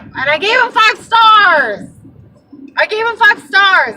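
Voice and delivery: high loud slow disbelief, five stars stressed; incredulous shout. Real, not acted. Ranting to viewers about rating a creepy driver, loud incredulous shout. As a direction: shout it like you can't believe yourself. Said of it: "disbelief at own rating".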